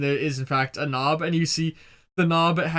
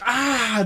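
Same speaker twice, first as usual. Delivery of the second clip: high, short mock cry of "Ah!"; mock scream; 6 semitones higher than usual. Game master voicing gunmen hit with silk in the eye, mock scream. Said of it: "and they're like".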